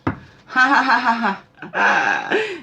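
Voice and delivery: loud high ha ha ha; big demo laugh. Shown as a demonstration. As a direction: belly laugh to invite everyone. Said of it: "So let's laugh".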